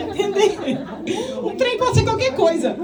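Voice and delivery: giggly, high-pitched explanation; laughing. Real, not acted. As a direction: laugh through the joke about her slang. Joking about mineiro slang.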